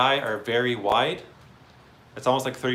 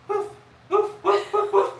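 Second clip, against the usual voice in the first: high loud playful barks; high woofs; 5 dB louder, slower than usual. Acted. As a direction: yip the woofs like a puppy. Barking along to the song.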